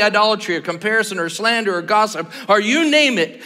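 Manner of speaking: earnest preacher; preaching. Acted. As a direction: press the call to confess. Preaching on confession.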